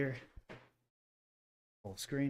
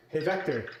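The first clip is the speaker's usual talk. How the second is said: calling out; 5 semitones higher, 7 dB louder than usual. Real, not acted. Calling the robot's name.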